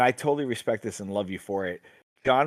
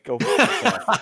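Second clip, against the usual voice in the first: mock insult; 9 semitones higher, 4 dB louder, slower than usual. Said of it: "I'm like".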